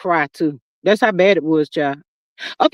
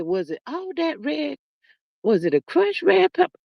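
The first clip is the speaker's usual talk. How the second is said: quiet wondering; 4 semitones higher, 7 dB quieter than usual. Retold or imitated. Retelling wondering what made her sick.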